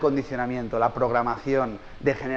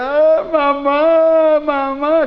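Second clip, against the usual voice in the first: put-on high, slow wailing "mamá" of a toddler; crying child; 11 semitones higher, 7 dB louder, much slower than usual. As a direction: cry out for mum like a hurt baby. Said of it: "empieza a llorar". (niña pequeña llorando, llamando a mamá en lamento agudo y lento)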